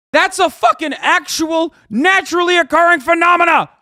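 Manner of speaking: yelling high and loud, voice cracking; furious shout. Real, not acted. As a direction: scream it in outrage until the voice cracks. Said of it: "Voice crack, voice crack".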